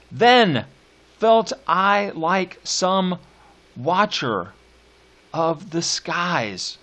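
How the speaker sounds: slow, measured poetry recital; drawn-out reading of a sonnet; poem reading. Acted. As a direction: lean on each stressed beat, slowly.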